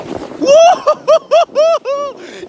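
high shouted laugh on the way down; shrieking laugh. Real, not acted. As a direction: let out a thrilled yelling laugh. Sledding fast downhill.

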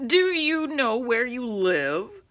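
slow, loud teasing question; taunting driver. Acted. Driver teasing Henry in front of everyone, slow and loud. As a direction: drag the taunt out slowly.

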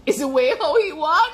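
breaking into real laughter mid-sentence; laughing. Real, not acted. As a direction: laugh it out as you make the point. Laughing at her own point.

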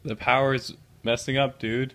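loud mock alarm. Teasing drugged friend, loud.

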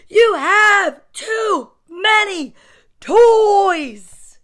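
slow, loud, high shout; yelling mom. A narrator's reading. Fed-up mom scolding her son over toys, yelling. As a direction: punch each word, stretching the last. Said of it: "she yelled on her way upstairs".

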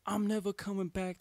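high-pitched hammed-up storming out; mock dramatic exit. Son walking out on dad, high mock-defiant declaration. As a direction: declare it like a dramatic runaway teen. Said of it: "I was basically doing, like, the".

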